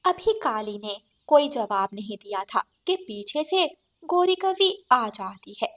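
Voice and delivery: ordinary delivery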